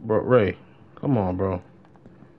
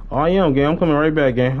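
calling out; 5 semitones higher, 6 dB louder than usual. Real, not acted. Shouting to teammate in game.

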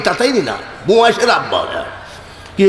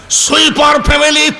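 mocking emphasis; 7 semitones higher than usual. Mocking the sweeper family.

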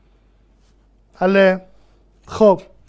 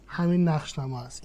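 usual speaking voice

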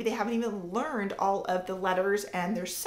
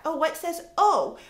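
higher, louder puzzled question; child wondering; 6 semitones higher, 5 dB louder than usual. Retold or imitated. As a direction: voice a child asking itself. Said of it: "they can think".

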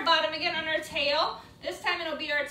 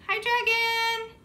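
high, drawn-out hello; singsong high greeting; playful greeting; 8 semitones higher, 4 dB louder, slower than usual. Pretend. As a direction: greet a puppet along with the kids. Children greet the puppet together.

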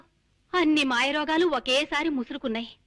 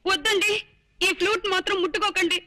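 high-pitched wifely wail, begging; tearful pleading; 5 semitones higher than usual. Acted. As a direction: plead with him as if his life depends on it. Wife begs husband to drop flute. (ఏడుస్తూ బతిమాలడం)

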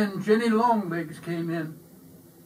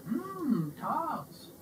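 put-on mild old lady voice; 7 semitones higher, 5 dB quieter than usual. A narrator's reading. Old lady craves tarts.

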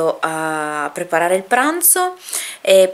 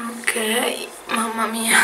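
breathy whisper to herself; 4 semitones higher, 6 dB quieter, much slower than usual. Real, not acted. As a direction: whisper it under your breath. Mutters to herself, hair mess.

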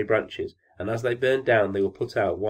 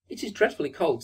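high-pitched mouse voice; lightly squeaky, pitched-up complaint; little mouse; 7 semitones higher than usual. A narrator's reading. Mouse to the old fir tree, small high voice. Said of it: "said the mouse".

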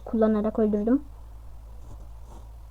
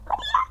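frustrated cry; 11 dB quieter, much faster than usual. Real, not acted. Frustrated at dying in game.

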